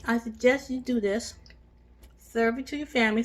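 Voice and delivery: talking with a mouthful; mouth full. Real, not acted. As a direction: speak round a bite of food. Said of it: "Sorry, I'm talking with my mouth full".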